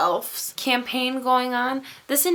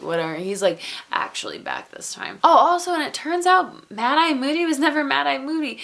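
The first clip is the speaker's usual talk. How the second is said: ordinary talk, nothing marked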